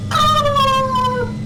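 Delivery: high drawn-out "aah"; mock falling scream. Imitates Mario falling.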